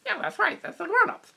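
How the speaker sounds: high, sly puppet voice. Acted. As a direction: agree knowingly as the puppet. Puppet answers the host.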